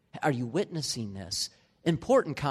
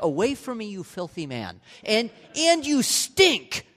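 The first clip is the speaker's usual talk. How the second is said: mock disgusted wife; 7 semitones higher, 5 dB louder than usual. Pastor imitating his wife pushing him away, mock disgust. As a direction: ham up a repulsed wife. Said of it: "she's like".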